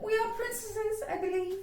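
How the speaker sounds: high, put-on airy sneer; mock-haughty schoolgirl; deriding. A narrator's reading. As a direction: taunt with pretend grandeur. Lavinia deriding Sarah.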